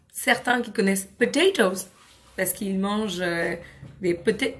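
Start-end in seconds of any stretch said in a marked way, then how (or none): none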